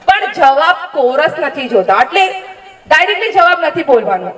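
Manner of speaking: ordinary talk, nothing marked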